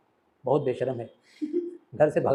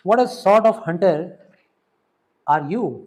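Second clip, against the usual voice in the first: scornful jeer; 3 semitones higher, 8 dB louder, much slower than usual. Acted. Natalia mocks hunter.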